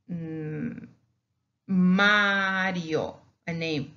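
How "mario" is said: Mario loud and drawn out, r lightly tapped, "MAH-dyo"; single R. Shown as a demonstration. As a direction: call out Mario Spanish-style with one tap. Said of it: "we pronounce it as ere".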